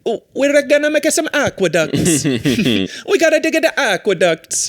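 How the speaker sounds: high, bouncy mock Italian accent; hammed-up Italian accent, a laugh between phrases. Ancient Roman planning public works, Italian accent. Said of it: "with an Italian accent".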